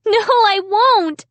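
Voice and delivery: high, loud refusal; defiant cry. A narrator's reading. Amy refusing the dummy, high and loud. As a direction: shout him down.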